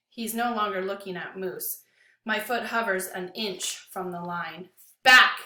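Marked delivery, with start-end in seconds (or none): 5.0-5.4 much louder barked command; bellowing. A narrator's reading. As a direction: bellow the word. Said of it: "Lewis bellows".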